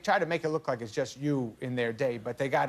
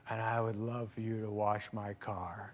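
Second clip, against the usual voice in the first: low, soft, slow deadpan punchline; quiet and slow, straight-faced; Harrison Ford; 4 semitones lower, 7 dB quieter than usual. Retold or imitated. Harrison Ford asking him to wash the car, quiet and slow. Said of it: "he goes".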